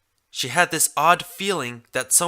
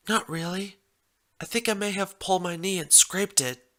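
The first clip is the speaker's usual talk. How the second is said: Red Heart, hurt and a bit embarrassed; 7 semitones higher than usual. A narrator's reading. Voices the hurt mare.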